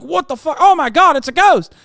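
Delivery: high, loud freak-out at a ghost; mock panic. Haunted resident discovers a ghost in the house, mock panic. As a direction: play someone shrieking in pretend terror.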